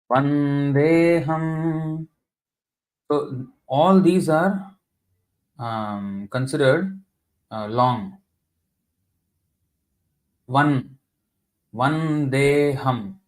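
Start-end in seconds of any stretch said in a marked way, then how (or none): 0.1-2.1 "Vandeham" with every syllable held long and slow. Shown as a demonstration. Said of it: "For example, you see now". 11.7-13.2 "Vandeham" with every syllable held long and slow. Shown as a demonstration. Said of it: "All are long".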